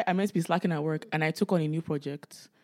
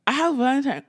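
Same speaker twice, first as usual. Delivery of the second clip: excited; 7 semitones higher, 6 dB louder than usual. Real, not acted. Excited announcement to friend.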